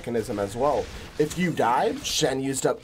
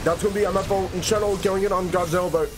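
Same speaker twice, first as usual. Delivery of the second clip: talking around a mouthful of ice; 4 semitones higher than usual. Real, not acted. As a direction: mouth full of ice, words slightly muffled. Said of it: "I have ice in my mouth right now".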